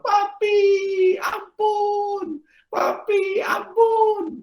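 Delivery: very high imitated child's wail; tearful pleading. Son begs papa for forgiveness, high mock-crying plea. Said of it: "Kenapa kamu menangis?".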